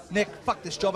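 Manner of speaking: usual speaking voice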